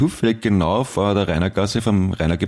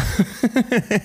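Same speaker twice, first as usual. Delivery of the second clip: high laugh. Real, not acted. Laughing at own insult.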